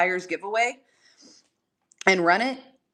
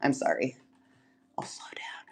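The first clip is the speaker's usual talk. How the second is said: ordinary talk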